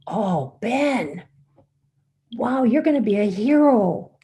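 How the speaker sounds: high, loud gush of wonder; awed admiration. Retold or imitated. Cheering up the stitched-up boy, acted awed admiration. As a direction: build up to loud, high amazement. Impressing the hurt boy, retold.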